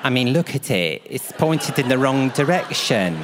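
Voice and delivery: slow, put-on nurse voice; hammed-up nurse examining an injury; imitated set nurse. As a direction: point out the obvious, drawn out. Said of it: "and she goes".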